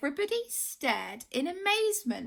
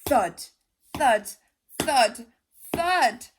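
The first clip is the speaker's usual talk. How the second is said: loud booming thud, thud, thud; heavy footsteps; 6 dB louder, slower than usual. A narrator's reading. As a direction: stomp each word like a giant's step. Monster footsteps again.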